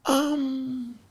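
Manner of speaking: high, halting, put-on stammer; baffled reply. Comedy elder answering a newcomer asking about pay, high baffled stammer.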